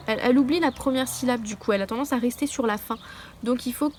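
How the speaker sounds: ordinary talk, nothing marked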